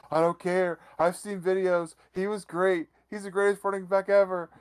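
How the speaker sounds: hammed-up teary voice; imitating crying fan. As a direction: play a grown man weeping over a hero. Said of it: "this man is crying alligator tears".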